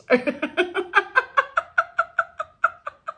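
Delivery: high, loud peals of laughter; long, high belly laugh that trails out; hearty laughter. Real, not acted. Leader laughing in her laughter session, hearty laughter.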